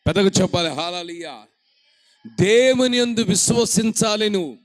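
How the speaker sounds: loud, slowing on the scripture; full-force preaching. Acted. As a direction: proclaim each phrase with weight.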